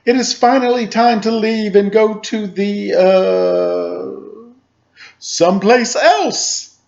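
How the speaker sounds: high, loud dinosaur dad, slowing at the end; big hammy papa voice, drawn out at the end; booming Papa Dinosaur. A narrator's reading. Papa Dinosaur announcing they leave, loud and sly. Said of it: "in his loud, booming voice".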